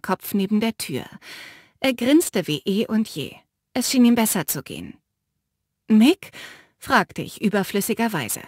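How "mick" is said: sharp rising question, louder; surprised question. Acted. The narrator spots Mick in the cell, surprised and loud. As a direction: call his name in disbelief.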